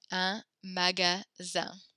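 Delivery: Un magasin said slowly for students to repeat; slow teacher model. Shown as a demonstration. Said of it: "repeat after me".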